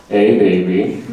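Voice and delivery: loud low seductive line; sexy voice. Shown as a demonstration. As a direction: pickup line with swagger. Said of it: "This is my sexy voice".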